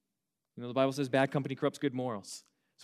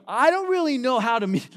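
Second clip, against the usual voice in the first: raised, louder and flustered; 10 semitones higher, 12 dB louder than usual. Retold or imitated. A teen flustered by a friend's confession, blurting it out. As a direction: blurt it out, caught off guard.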